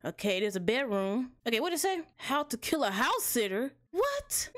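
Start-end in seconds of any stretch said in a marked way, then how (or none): 3.9-4.5 a shocked high squeak; sudden high yelp; startled. Real, not acted. Reads how to kill house sitter.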